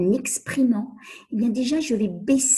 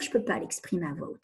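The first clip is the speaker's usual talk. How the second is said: mock objection; 4 semitones lower, 6 dB quieter, faster than usual. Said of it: "Alors vous allez me dire".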